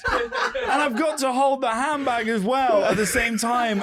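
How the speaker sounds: high-pitched comic whine of excuses; hammed-up flustered self-defence; flustered protest. Acted flustered excuse while auditioning.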